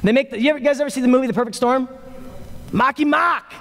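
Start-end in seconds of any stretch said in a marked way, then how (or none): 2.7-3.5 higher-pitched mock Boston accent. Preacher quoting a movie to the congregation, mock Boston accent. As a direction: call it out in a hammed-up Boston accent. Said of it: "talk in that Boston accent".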